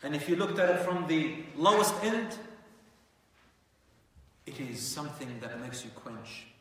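normal talking voice

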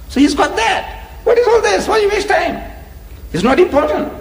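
high, mocking voice of a complacent believer. Preacher mocking a believer who shrugs off the law. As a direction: sneer out his easy dismissal.

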